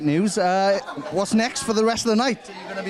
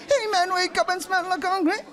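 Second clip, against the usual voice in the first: high nasal-free rock singer squeal; [name] impression; 11 semitones higher than usual. Shown as a demonstration. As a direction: belt it like a nu-metal frontman. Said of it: "do an impression of [name]".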